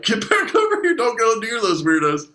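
put-on shrill-free, high-pitched worried mom calling her kid; scolding mom. Host retelling stoned night, mocking moms shooing kids away. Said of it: "their moms are like".

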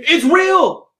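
high, emphatic insistence; insistent exclamation. Real, not acted. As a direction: cut in to be believed. Insisting over friends' interruptions.

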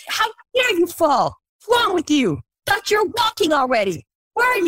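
hammed-up scold at a baby; harsh scolding parent. Parent berating a toddler for falling, mock harsh. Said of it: "We're not like".

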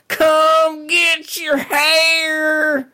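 very high, long-drawn hawker's cry; greasy toothless carnival barker. Acted. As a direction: holler it like a toothless circus stall vendor. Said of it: "weird kind of greasy, like, toothless guy".

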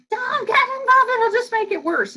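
very high, whiny mock teen protest; screaming teen. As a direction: shriek it like a desperate teenager. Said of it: "the kids are gonna scream".

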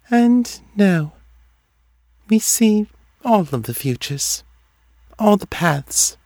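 high, detached voice with long pauses; dreamy seer. A narrator's reading. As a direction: let each phrase drift out separately, pitched high.

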